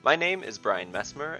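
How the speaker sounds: ordinary delivery, nothing marked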